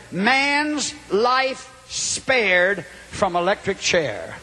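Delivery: very slow, drawn-out headline; dramatic headline reading. Retold or imitated. Preacher reading the paper that awakened him, drawn-out headline.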